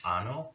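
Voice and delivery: "ano" with a plain n, no y sound. Shown as a demonstration. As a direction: say "ano" with a simple n. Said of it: "it's two different things".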